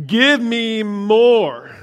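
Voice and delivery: high, loud, very drawn-out demand; imitating eager toddler. Dad voicing his baby son after tasting ice cream, demanding. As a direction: stretch it out like a greedy little one. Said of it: "and he was like".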